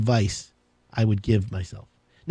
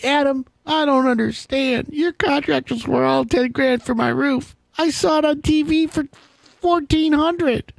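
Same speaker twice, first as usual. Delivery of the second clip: higher, slower put-on voice of a baffled homeowner; naive client; 8 semitones higher, slower than usual. Homeowner complaining to Adam about contractor quotes, mock whiny high voice. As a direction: whine it like a customer who trusts TV prices. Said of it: "can you imagine, this happened to me".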